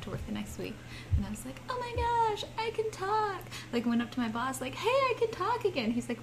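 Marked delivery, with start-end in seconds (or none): 1.7-3.4 excited. Retold or imitated. Amazed to have voice back. 4.7-5.9 excited. Retold or imitated. Telling boss voice is back.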